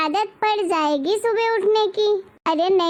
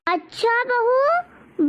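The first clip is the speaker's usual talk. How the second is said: high, very slow sarcastic drawl; 4 semitones higher, much slower than usual. Acted. Mother-in-law answering the rude bride, sarcastic drawl. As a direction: stretch "अच्छा" in disbelief.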